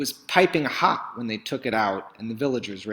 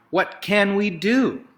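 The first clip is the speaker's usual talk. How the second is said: high, loud pleading cry; a worried crowd crying out; villagers crying out; 9 semitones higher, 4 dB louder than usual. Acted. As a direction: cry out in despair. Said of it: "the villagers cried".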